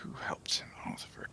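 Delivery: soft unvoiced whisper; hushed whisper to the table. Real, not acted. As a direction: whisper the question aside.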